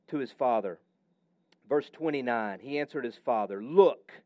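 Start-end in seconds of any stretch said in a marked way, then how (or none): 3.6-4.1 curt, disrespectful. Retold or imitated. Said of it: "doesn't even address his father respectfully".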